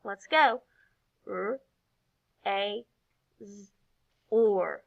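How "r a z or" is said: "R, A, Z, or" each sound by itself; individual sounds. Shown as a demonstration. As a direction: isolate "R, A, Z, or" with pauses between. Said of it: "say each individual sound by itself".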